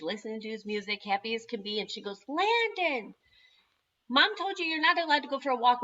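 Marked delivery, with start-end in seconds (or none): 2.3-5.8 calling out. Retold or imitated. Sister calls out to brother.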